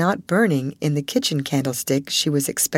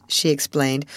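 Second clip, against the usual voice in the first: plain reading voice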